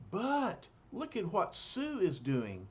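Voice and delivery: usual speaking voice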